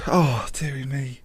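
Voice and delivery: soft, slow whispered sigh. Real, not acted. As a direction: breathe it out in a whisper. Quiet aside after win.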